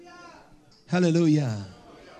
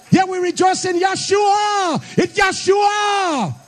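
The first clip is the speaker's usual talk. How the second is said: rising preacher shout; 7 semitones higher, slower than usual. Acted. Preacher calling the church to rejoice, shouting. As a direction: shout it out high, dragging out Yeshua.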